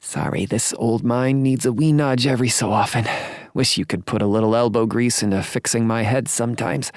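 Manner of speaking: self-mocking elderly lady, quickened; old lady voice. A narrator's reading. Thelma apologizes.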